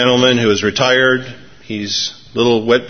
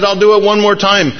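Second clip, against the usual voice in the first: high-pitched, mock-panicked determination; panicked resolve; 8 semitones higher, 3 dB louder than usual. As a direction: act out a flustered preacher, pitched up. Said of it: "He's panicked".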